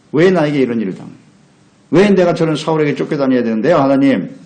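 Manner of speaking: mock aggrieved outcry; protesting to God. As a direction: demand an answer from God, pushing up in pitch and volume. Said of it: "하고 따지는 거예요".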